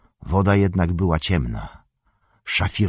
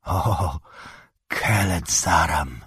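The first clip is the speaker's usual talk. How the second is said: sighed; 4 semitones higher, much slower than usual. A narrator's reading. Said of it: "westchnął Gimli".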